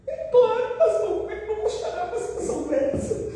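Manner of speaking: mock tearful cry to the pastor; distressed outburst. Retold tearful reply.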